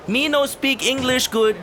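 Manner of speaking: high mock broken English; broken-English accent. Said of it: "speaking Japanese with a Chinese accent".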